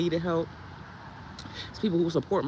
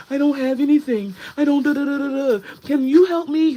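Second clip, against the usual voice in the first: high, loud whiny mock plea; mock beggar; 9 semitones higher, 9 dB louder than usual. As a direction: mimic a pitiful beggar on TikTok, hammed up. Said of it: "I don't come to TikTok and be like".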